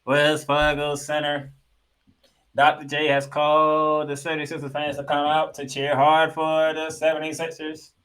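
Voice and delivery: booming public address announcer; arena announcer voice. Pretend. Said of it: "trying to sound like the O P A announcer of the Spectrum".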